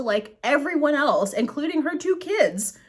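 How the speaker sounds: ordinary talk, nothing marked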